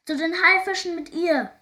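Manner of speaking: high, loud pirate holler; raging captain shouting to his crew; bawling roar. A narrator's reading. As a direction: bawl out the sentence in a rage. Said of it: "grölte er".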